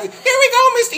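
put-on very high, loud kid voice; squeaky imitation of kids calling out; mock children's voices. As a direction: pipe up like an excited little kid. Voices gang of young kids.

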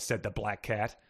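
ordinary talk

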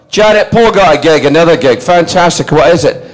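high, loud imitation of an overjoyed dad; excited dad. Dad telling Janet about another gig, excited. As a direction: relay it high and loud to your wife.

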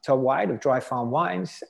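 usual speaking voice

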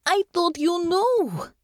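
high, drawn-out protest; crying out. A narrator's reading. Natasha protests to Honor, crying out. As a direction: cry it out high and slow, dismayed. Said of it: "Natasha cried".

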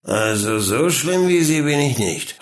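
put-on drunken slurred father voice; slurring. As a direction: slur the words like a drunk. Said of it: "lallte er".

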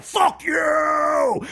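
high, loud screeching curse; Chucky's rage. Retold or imitated. Fan voicing Chucky's outburst, a high screeching curse. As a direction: spit it out like a furious doll. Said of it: "He's like".

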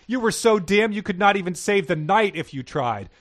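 mock her with a sneer; lightly voiced sarcastic girl. A narrator's reading. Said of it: "Rebecca said sarcastically".

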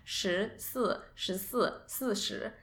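十四 and 四十 contrasted softly; Mandarin numbers. Shown as a demonstration. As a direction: say 十四, 十四, 四十 carefully. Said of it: "you can hear how similar".

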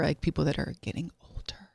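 hushed aside, breathy and unvoiced; whispering. Real, not acted. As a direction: drop to a whisper so no one hears. Quiet joke so producer won't hear.

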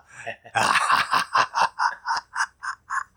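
whispery villain cackle; long, breathy staccato evil laugh; scary laugh. Shown as a demonstration. Host showing off his spooky skills, evil cackle. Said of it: "I can do those kind of things".

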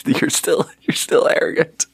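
high, breaking into real laughter while talking; laughing. Real, not acted. Speaker answering own question about arrogance, laughing. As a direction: crack up at yourself mid-sentence.

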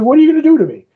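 high, loud, panicked question; a retold plea of fear, pitched high and loud; frightened plea. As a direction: beg in alarm, voice jumping up high. Lyta terrified, confronting Dream.

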